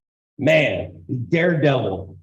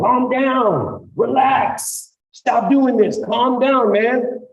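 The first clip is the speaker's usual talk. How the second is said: raised, firm calming commands; calm officer commands; 8 semitones higher, slower than usual. Shown as a demonstration. Officer talking down a struggling suspect, firm and raised. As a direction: talk the suspect down firmly. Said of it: "tell them".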